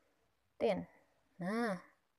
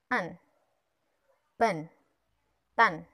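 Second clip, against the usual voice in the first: loud drill; 2 semitones lower, 10 dB louder, much slower than usual. Shown as a demonstration. Teacher drilling syllables for children.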